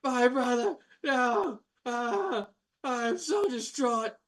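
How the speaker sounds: high, wailing mock lament; a sky-high fake sob of a brother; hammed-up grief. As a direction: overplay the brother's despair, ending in a shout. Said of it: "falls to his knees and goes".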